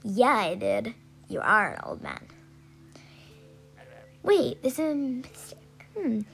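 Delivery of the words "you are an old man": put-on gruff old man. Voicing game character reply.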